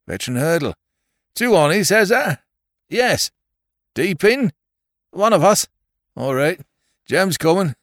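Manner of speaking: loud country yokel voices; rustic farmers calling. Acted. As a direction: call like moorland farmhands. Rustic rescuers conferring.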